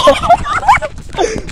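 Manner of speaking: shrieking laugh while running; high, loud laughing burst; breathless high laugh. Real, not acted.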